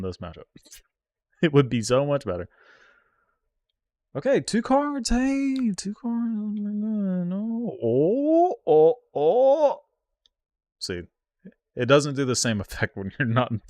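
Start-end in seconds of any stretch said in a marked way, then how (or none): 4.2-9.8 anguished high wail. Real, not acted. Streamer squealing at the opponent's two-card block. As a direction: voice leaps very high and loud in dismay.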